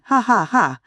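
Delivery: high, loud villain's laugh; mocking laugh. A narrator's reading. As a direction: cackle at the frightened boy.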